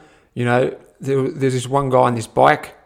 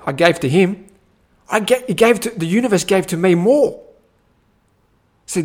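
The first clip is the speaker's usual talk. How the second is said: ordinary delivery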